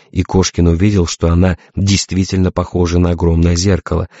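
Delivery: ordinary delivery, nothing marked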